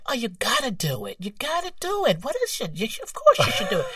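put-on high-pitched New York accent, insistent. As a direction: imitate excited New York bosses urging you on. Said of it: "That's a great accent".

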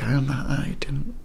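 breathy unsure whisper, trailing off; barely voicing the doubt; hesitant whisper. Real, not acted. Photographer unsure whether to join colleagues, whispering hesitantly. Unsure about joining the staff.

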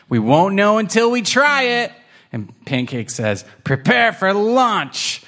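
high, decisive kitten; rally for the launch; determined kitten. Acted.

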